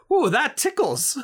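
high, slow giggly squeal; high tickled squeal. Acted. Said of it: "We ended with".

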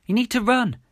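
loud, high urgent shout. A narrator's reading. Urging injured Millie onward, high shout. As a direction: shout it to get someone moving. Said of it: "I shouted".

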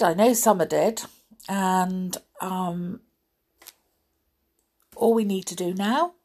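plain reading voice

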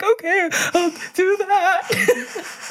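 very high, drawn-out fake sob; hammed-up weepy compliance; mock-tearful agreement. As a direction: whimper it slowly through a laugh. Retold tearful, reluctant agreement.

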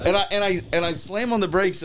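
ordinary talk, nothing marked

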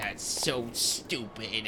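slow, soft, sore-loser groan; drained, drawn-out sulk; beaten, limping. Acted. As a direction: grumble it weakly after a defeat. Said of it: "like, limp up to them".

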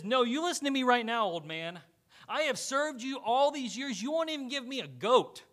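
loud, high, angry rant at his father; a resentful son telling off his old man. Acted. Older son confronting his father about the goat, loud and angry.

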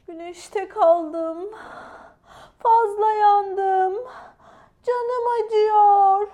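slow, high, loud wail; hammed-up tearful mermaid; crying. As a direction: wail each phrase in long drawn-out cries. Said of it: "diye ağlıyordu".

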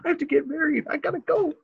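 very high, laughing frantic pleading; hammed-up frantic groom; panicked groom. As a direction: squeal that you must get married now. Groom frantically leaving the hug.

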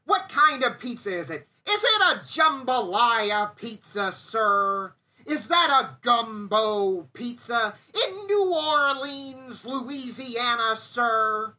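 high, slow, savoring mock promo; Heyman impression. Heyman needling the nervous crew guy, high and slow. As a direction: drag each pizza out like a taunt. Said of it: "And I go".

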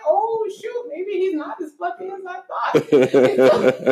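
high voice dissolving into laughter; drawn-out high self-mocking thought; mock dismayed realization. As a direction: play sudden doubt while cracking up. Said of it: "And I'm like".

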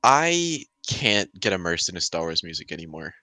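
ordinary talk

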